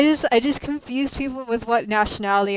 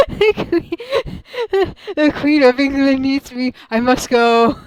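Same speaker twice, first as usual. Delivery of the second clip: mock royal Brit; 3 semitones higher, 9 dB louder than usual. Streamer mocks being called British, grand put-on voice. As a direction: puff up like a pompous royal subject.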